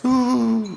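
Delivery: high, imitated crying wail; mock bawling. Storyteller imitating the weeping taxi driver, mock bawl. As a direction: let out a small mock sobbing "ooh". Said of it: "he's actually bawling".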